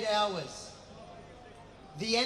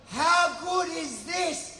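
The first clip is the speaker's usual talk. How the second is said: drawn-out, higher call to the crowd; rallying shout; 4 semitones higher, slower than usual. Real, not acted. Speaker hyping the rally crowd, rallying shout. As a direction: throw it out to a big crowd.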